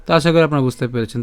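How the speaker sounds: usual speaking voice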